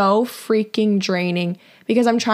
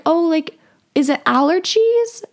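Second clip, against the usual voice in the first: higher-pitched, tentative imitation of a stranger; awkward small talk; 8 semitones higher than usual. Customer making small talk with a crying cashier, awkwardly. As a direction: mimic an awkward customer, pitch climbing on the question. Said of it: "trying to make small talk with me".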